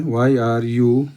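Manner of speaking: very slow, word by word; slow dictation. Shown as a demonstration. Teacher dictating the answer to students, very slow. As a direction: spell it out slowly for the class to copy. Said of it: "teacher dictates partial sentence".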